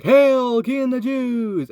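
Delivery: high, loud, slow mock hail. Jeering crowd mocks Jesus, loud high sneer. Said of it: "people are like".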